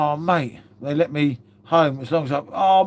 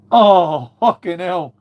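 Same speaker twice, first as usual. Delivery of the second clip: startled exclamation; 7 dB louder, much slower than usual. Real, not acted. Sudden outburst on live stream.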